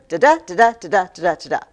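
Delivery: da-DA beats tapped out evenly; iambic rhythm. Shown as a demonstration. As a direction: chant the iambic beat like clapping. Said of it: "This one is iambic pentameter".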